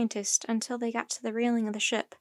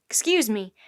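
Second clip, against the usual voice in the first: plain reading voice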